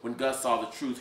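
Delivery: usual speaking voice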